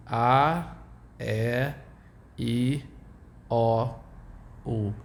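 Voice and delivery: A E I O U said the Portuguese way, ah eh ee oh oo; name the vowels as a Brazilian would. Shown as a demonstration. The teacher names the vowels in Portuguese. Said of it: "Those are the names in Portuguese".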